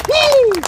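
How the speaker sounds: high whooping cheer; rally crowd whoop; excited cheer. Real, not acted.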